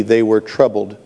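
normal talking voice

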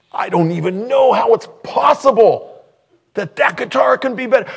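high and loud, full of disbelief; astonished. Retold or imitated. Pastor marvelling at the final guitar, high loud disbelief. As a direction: sound unable to believe it.

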